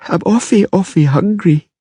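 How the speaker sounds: put-on high whining little girl; a hungry girl complaining; whiny child. A narrator's reading. Ciri complaining.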